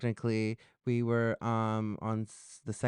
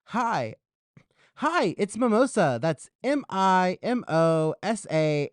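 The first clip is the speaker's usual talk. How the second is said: high, projected sing-song greeting; show-host intro; 5 semitones higher, 4 dB louder, slower than usual. Acted. Host restarts the intro for viewers, presenter intro. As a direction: announce yourself big, spelling the name out slowly.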